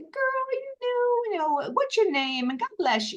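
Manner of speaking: high-pitched, overdone Southern accent, admittedly badly done; Southern accent impression. Woman at a meeting blessing the newcomer, in a mock Southern accent. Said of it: "I can't even do the accent".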